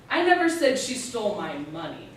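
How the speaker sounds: "money" given the final emphasis; stress on money. Shown as a demonstration. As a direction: save the weight for "money". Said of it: "You can stress each word in that sentence".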